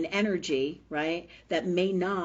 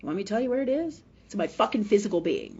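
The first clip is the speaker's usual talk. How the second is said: ordinary talk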